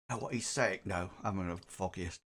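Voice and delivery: very soft, low murmur; leaning-over aside. Acted. Crowd member leans to a neighbour during speech, hushed aside. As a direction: keep it under the breath. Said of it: "somebody leans over and says".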